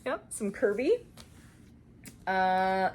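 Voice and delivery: usual speaking voice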